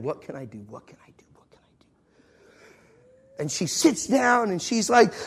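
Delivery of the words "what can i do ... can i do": soft voice fading into whisper; inner thought. Retold or imitated. Traveler plotting to himself by the window, fading whisper. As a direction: repeat it to yourself, sinking to a barely audible whisper. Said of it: "thinking in my head".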